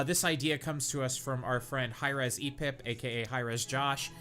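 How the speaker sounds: ordinary talk, nothing marked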